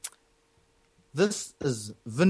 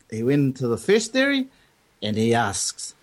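ordinary talk, nothing marked

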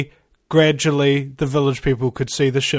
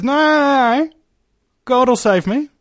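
confident refusal; 8 semitones higher than usual. Retold or imitated. Man on roof turns down powerboat rescue, confident refusal. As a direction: wave the help away, pitched up.

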